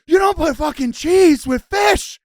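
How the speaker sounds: high, loud mock outrage; imitating furious dad. Host imitates his friend's dad exploding over cheese, loud and high. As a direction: play the scandalised Brooklyn dad. Retells Frank yelling the rule.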